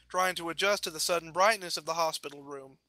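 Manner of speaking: ordinary delivery, nothing marked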